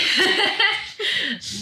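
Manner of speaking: high, loud burst of laughter. Real, not acted. [name] laughing at her own retort to the doctor, loud high laugh. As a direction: laugh hard at the absurdity.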